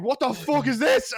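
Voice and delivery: high-pitched, loud re-enacted rant; screaming outrage. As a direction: yell for the manager in disbelief. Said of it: "I started screaming for the manager".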